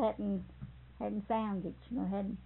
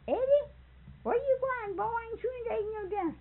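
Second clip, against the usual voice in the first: cook calling out; 12 semitones higher than usual. Retold or imitated. The kitchen cook calling after Eddie as he runs, high and fast. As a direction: call after the running boy.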